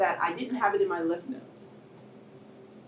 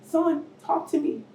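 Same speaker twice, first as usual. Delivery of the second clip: desperate plea; 8 semitones higher than usual. Retold or imitated. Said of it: "I'm like".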